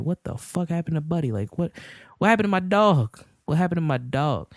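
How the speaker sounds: mock angry. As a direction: demand answers as an upset dog owner. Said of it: "You get mad".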